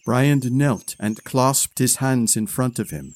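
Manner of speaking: ordinary delivery, nothing marked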